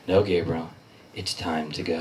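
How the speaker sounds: low, quiet, grave voice of doom; ominous nurse. Retold or imitated. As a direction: say it like death itself calling you. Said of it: "she says the worst fucking thing".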